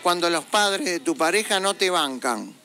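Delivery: usual speaking voice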